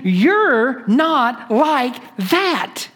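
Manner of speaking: "You're not like that" very slow, each word weighted; emphatic word-by-word stress. Retold or imitated. Preacher voices Paul pressing the point, slow emphatic "You're not like that". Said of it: "I want to emphasize this point".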